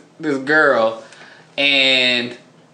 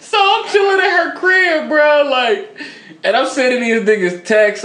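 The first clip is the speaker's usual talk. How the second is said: excited; 12 semitones higher, 3 dB louder than usual. Real, not acted. Bragging about comfy stay.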